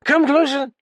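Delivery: high, loud beckon; drunk beckoning. Acted. Drunk calling the narrator to the table, high. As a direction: draw him close. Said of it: "he beckoned".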